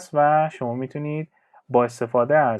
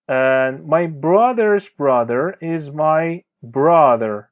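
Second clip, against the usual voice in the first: very slow, careful teacher reading; slow clear example; 4 dB louder, much slower than usual. Shown as a demonstration. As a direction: spell each word out slowly for learners. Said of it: "teacher reads example sentence". (جملهٔ نمونه را خیلی آهسته و شمرده بخوان)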